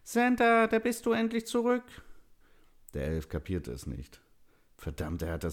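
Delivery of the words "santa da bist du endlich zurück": very high, loud character cry; cheerful call. A narrator's reading. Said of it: "der lächelte und rief".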